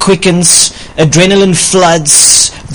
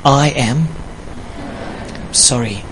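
lower, softer, heartfelt sorry; sincere apology demo; 4 semitones lower, 9 dB quieter than usual. Shown as a demonstration. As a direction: model a humble, sincere apology. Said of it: "let's practice".

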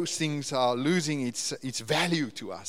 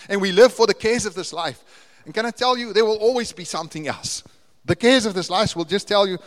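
louder, rising sermon climax; full-force preaching; 5 semitones higher, 9 dB louder than usual. Acted. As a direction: preach it out to the congregation, building.

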